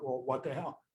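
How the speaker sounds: a low, puzzled aside nobody else should hear; baffled under breath. Retold or imitated. Baffled inner reaction, quoted.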